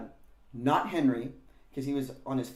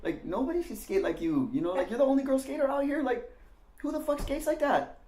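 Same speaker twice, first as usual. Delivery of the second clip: praising Randy; 11 semitones higher than usual. Retold or imitated. Randy telling Danielle she's the coolest girl skater, high and stoked. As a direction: hype her up like a stoked older skater.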